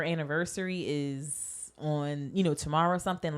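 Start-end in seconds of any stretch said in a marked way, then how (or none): none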